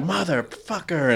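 soft, high, drawn-out curse; exasperated swearing under control; retold frustrated curse. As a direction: stretch the swear out slowly, kept quiet. Retold curse at spilled dinner.

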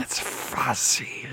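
very slow rasping whisper; menacing witch. Acted. Unseen witch appraising strangers, menacing slow whisper. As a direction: hiss it out slowly, all breath and rasp. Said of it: "that is menacing".

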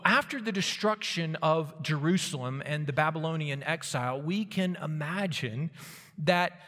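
ordinary talk, nothing marked

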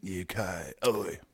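quiet mock Cockney aside; mock British accent. Co-host echoes UK softly in mock British accent. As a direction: toss it out in British slang style.

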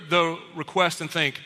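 normal talking voice

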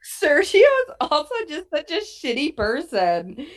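normal talking voice

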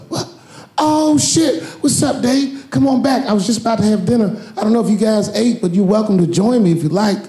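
high, excited, fast-talking star; Kevin Hart impression. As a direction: squeal with surprise, then rattle on fast. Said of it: "Kevin came around the corner".

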